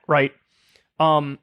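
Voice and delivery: usual speaking voice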